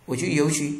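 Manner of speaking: normal talking voice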